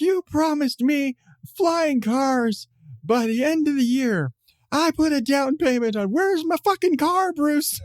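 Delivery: high, drawn-out mock tirade; Joker berating. Fan plays Joker berating Bruce Wayne, high outraged rant. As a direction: scold him in a high, hammed-up villain voice. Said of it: "he proceeds to berate Bruce Wayne".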